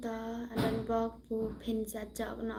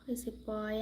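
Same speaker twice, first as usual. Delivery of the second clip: whisper; 2 semitones lower, 6 dB quieter than usual. Real, not acted. Teasing a viewer on stream, whispered.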